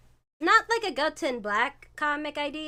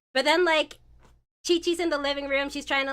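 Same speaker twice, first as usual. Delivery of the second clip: ordinary talk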